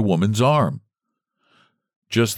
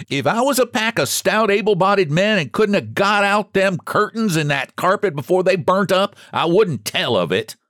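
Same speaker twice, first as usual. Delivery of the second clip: high, indignant scolding; loud voice; 11 semitones higher than usual. A narrator's reading. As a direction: scold them all in a raised voice. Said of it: "said she in a loud voice".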